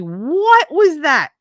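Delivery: high, loud, drawn-out shock; startled. Retold or imitated. Son startled by mom's screech, high loud shock. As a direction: jump at a sudden noise, slow and high.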